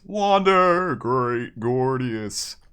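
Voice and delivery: a loud, drawn-out, stately greeting to a viewer; grand greeting. Acted. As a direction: welcome him like a herald.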